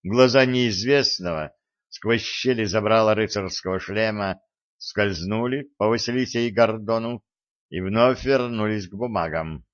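ordinary delivery

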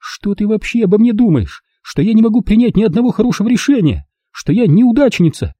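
high, bitter outburst; bitter tirade. Acted. Lauren confronts mother at the table, high and bitter. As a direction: pour it all out.

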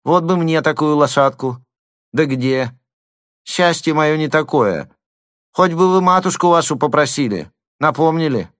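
high wheedling lisp. A narrator's reading. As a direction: beg sweetly with a lisp. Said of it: "своим шепелявым голосом".

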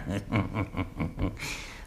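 soft sly snicker; quiet gloating chuckle. Acted. Miser chuckling over his greedy scheme. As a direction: chuckle at your own cunning.

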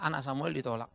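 ordinary delivery

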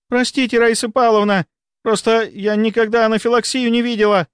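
high, anxious excuse; flustered apology. A narrator's reading. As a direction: plead with a scolding senior. Flustered nurse apologises when scolded.

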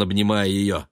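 ordinary delivery

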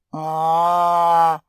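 loud, drawn-out groan; long groan. A narrator's reading. Cat Кузьма fed up with the pug, groaning. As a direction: groan long and loud on one level note. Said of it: "простонал Кузьма".

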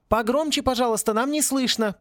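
high calling out through a door. A narrator's reading. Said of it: "прокричал Сен". (громко, через дверь)

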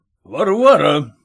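plain reading voice

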